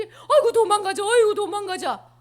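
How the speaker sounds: loud, high fleeing shout with laughter; panicked cry. Acted. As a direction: run for it, half laughing. Merchants flee in panic.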